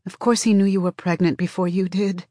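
plain reading voice

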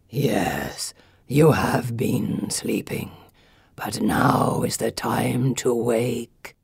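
very low, slow head gargoyle; deep as time. A narrator's reading. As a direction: intone it slowly from the depths, like ancient stone. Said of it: "His voice is as deep as time".

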